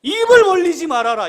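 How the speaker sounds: loud, high, ringing order; forceful preacher's decree; emphatic command. Acted. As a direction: proclaim the command at full voice. Preacher quotes God's order emphatically.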